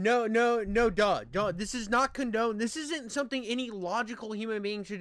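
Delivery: loud, emphatic no; loud, disapproving no; loud protest. Real, not acted. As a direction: shout him down.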